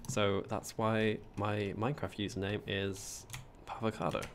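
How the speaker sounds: usual speaking voice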